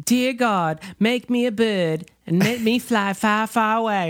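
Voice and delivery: mock child's Southern accent, drifting into English royalty. Imitating Jenny's prayer in the movie, Southern accent gone English. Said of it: "Southern accent or English royalty?".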